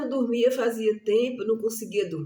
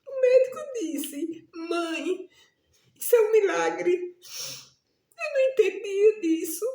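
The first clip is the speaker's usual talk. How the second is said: tearful retelling; 8 semitones higher than usual. Retold or imitated. Doctor announcing miracle, she weeps.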